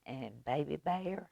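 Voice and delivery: ordinary delivery, nothing marked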